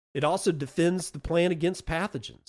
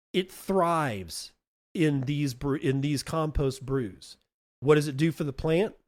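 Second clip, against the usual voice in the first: ordinary delivery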